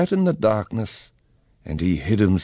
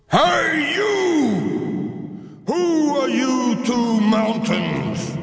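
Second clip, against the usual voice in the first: booming shout; slower than usual. Acted. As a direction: boom it out like a giant mountain. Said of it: "boomed a thunderous voice".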